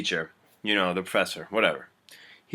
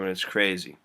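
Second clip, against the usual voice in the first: ordinary talk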